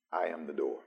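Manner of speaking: low and soft, hushed and settled; quiet reprise. Acted. Pastor softly restating Jesus' words to the church, quiet preaching. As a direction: bring it down to a near hush.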